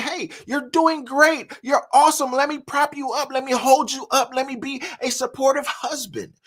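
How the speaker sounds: prop her up like a gentle husband pleading; consoling husband. Retold or imitated. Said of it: "He's trying to console her".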